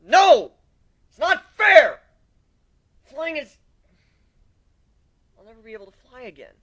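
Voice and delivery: loud, high cry of despair; howling grief and rage; anguished shout. Acted. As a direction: scream it at the unfairness. Anguish at losing his wing.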